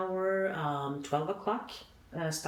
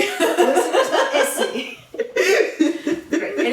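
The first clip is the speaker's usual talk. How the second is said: much higher and louder, bursting into laughter; laughing loudly; 14 semitones higher, 16 dB louder, slower than usual. Real, not acted. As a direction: shout the address back through a big laugh. Both laugh repeating the address.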